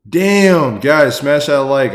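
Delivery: high, loud overwhelmed yelling; loud, high thanks to a generous donor; overjoyed shouting. Real, not acted. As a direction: shout your thanks, truly stunned.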